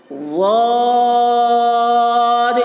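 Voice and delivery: ضاد stretched very long, thick; long held letter name. Shown as a demonstration. Teacher demonstrates thick ضاد for students, drawn out. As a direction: hold ضاد out heavy and slow. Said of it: "دیکھیے ضاد کی آواز بھی موٹی ہے".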